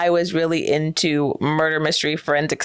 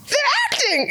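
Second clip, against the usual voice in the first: high, laughing mock outburst; retold realization. Teen at murder mystery dinner working it out, high laughing outburst. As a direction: blurt it out high through laughter. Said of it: "I go".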